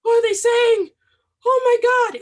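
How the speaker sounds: very high, loud bewildered cry; shocked outcry. Retold or imitated. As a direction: blurt it out in total disbelief at the strange accents. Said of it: "I'm like".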